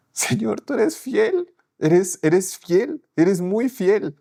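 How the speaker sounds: slow, high, tearful praise; slow, trembling thanks to God, pitched high; moved to tears. Real, not acted. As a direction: let the voice break with gratitude. Moved prayer after exam news.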